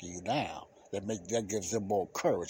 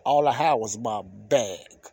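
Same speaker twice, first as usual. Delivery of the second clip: normal talking voice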